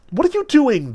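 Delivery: high, loud anguished cry; pained cry. A narrator's reading. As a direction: cry out in pain and alarm. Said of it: "the master cried painfully".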